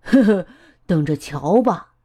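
light chuckle into the line; chuckle, then speak teasingly low-key; giggling. A narrator's reading. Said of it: "艾玛咯咯笑".

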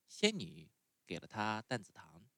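usual speaking voice

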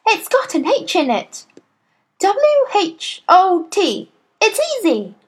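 put-on high, excited little boy; eager schoolboy. A narrator's reading. Boy thinks he has the answer, eager. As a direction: pipe up high with sudden confidence.

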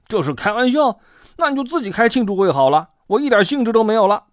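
high, fast indignant outburst; loudly, angrily. A narrator's reading. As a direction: fire back in a huff. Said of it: "蹦蹦大声地说".

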